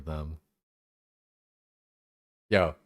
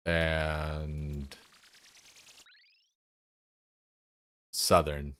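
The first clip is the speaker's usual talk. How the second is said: Southern English accent; 5 semitones lower, 7 dB quieter, much slower than usual. Shown as a demonstration. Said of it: "How'd I do there?".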